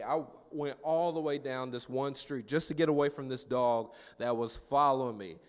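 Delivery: normal talking voice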